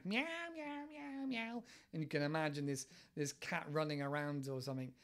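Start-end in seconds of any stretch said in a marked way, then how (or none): 0.0-1.6 high, playful mock meowing; playful cat meows. Teacher playing the escaped cat, high playful meows. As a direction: meow like a cat let out of the bag.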